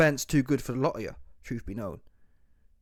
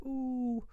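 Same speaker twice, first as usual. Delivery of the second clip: high, soft drawn-out "ooh"; mock whiny ooh; 11 semitones higher, 7 dB quieter than usual. Mocking a fighter who cries when you fight back, mock whimper.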